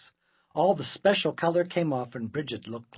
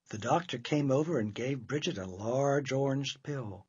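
plain reading voice

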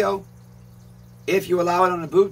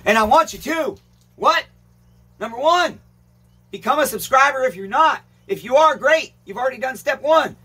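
excited; 7 semitones higher than usual. Real, not acted. Hyped call to subscribe.

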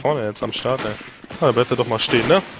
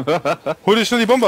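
urgent high callout; panicking gamer; 8 semitones higher, 4 dB louder than usual. Real, not acted. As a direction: push them to grab it fast. Urging teammate.

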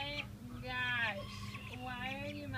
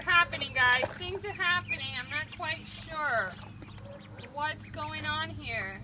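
loud; 8 dB louder than usual. Real, not acted. Commenting on ducks fighting.

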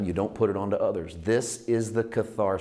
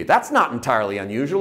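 ordinary delivery

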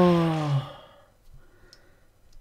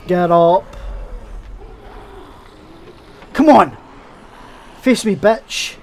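loud, challenging yell at the enemy; shouting a challenge across the room; shouted taunt; 3 semitones higher, 10 dB louder than usual. Real, not acted. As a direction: bellow a dare to fight.

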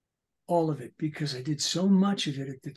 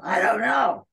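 loud, higher shouted reply; shouting character; 7 semitones higher, 9 dB louder, slower than usual. Retold or imitated. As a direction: yell it back at yourself. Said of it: "talk to myself".

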